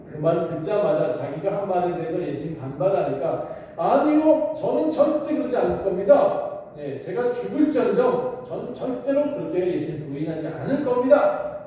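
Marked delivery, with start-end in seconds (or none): 3.8-11.6 hothead swearing he'll never deny; boastful protest. A narrator's reading. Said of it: "호언장담을 했습니다".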